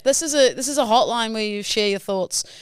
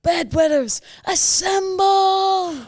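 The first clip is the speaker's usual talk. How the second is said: slow, stretched booming shout, much higher and louder; rallying shout; 12 semitones higher, 5 dB louder, much slower than usual. Pretend. Pitching a rally cry for bedwetters, drawn-out loud shout. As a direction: call the troops together at full volume. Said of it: "We could go".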